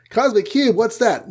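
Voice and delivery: high-pitched mock surprise; baffled question. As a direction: echo it back as a puzzled, squeaked-up question. Team's baffled reply.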